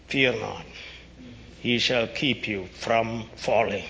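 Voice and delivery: usual speaking voice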